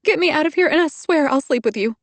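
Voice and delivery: high, loud desperate cry. A narrator's reading. Trapped woman to Hardy, high desperate cry. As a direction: insist in desperation. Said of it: "I said desperately".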